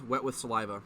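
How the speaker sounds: ordinary talk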